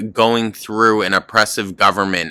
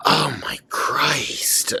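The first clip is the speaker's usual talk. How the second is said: whispered exasperation; 5 semitones higher, 4 dB quieter, slower than usual. Real, not acted. Exasperated reaction to the video.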